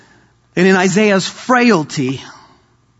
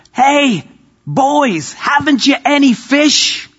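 calling from shore; 10 semitones higher, slower than usual. Acted. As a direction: call out to the boat from the shore. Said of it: "a voice from shore calls out".

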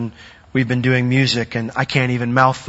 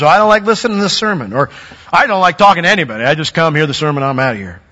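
dismissive churchgoer; 3 semitones higher, 4 dB louder, much faster than usual. Retold or imitated. Pastor imitating bored churchgoers, fast dismissive mock voice. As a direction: rattle off a lazy excuse. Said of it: "I've met some who say".

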